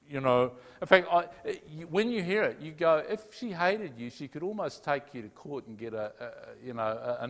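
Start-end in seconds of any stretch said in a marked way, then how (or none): none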